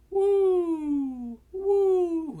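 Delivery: high, loud mock crowd whoops; hammed-up distant crowd woo; crowd cheering. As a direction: imitate a stadium cheering from afar. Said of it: "it's just like".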